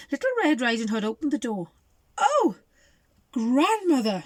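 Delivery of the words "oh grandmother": put-on high, louder surprised girl; gasp. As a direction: gasp in shock at grandmother. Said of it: "she gasped".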